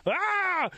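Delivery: high startled yelp of the security guard; a guard's surprised high-pitched ah. Retold or imitated.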